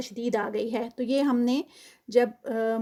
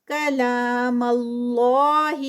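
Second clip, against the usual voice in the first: slow drawn-out tajweed recitation, vowels held; Quran recitation; 4 dB louder, much slower than usual. Shown as a demonstration. Tajweed teacher reciting a verse for students, slow melodic recitation. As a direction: chant each word with its lengthening. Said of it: "لا پہ ٹو counts".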